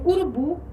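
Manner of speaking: urubu com BU final mais forte e agudo; tônica em BU. Shown as a demonstration. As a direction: suba a voz no BU de urubu. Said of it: "a sílaba tônica na última posição". (urubu com força na última sílaba, BU)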